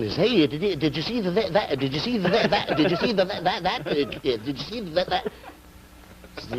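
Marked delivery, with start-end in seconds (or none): 0.2-5.3 high-pitched comic stammer, looping on the words; hammed-up stutterer urgently trying again; bad stutter. Stuttering guy starts again with his friend, high and stammering. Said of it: "The guy starts again".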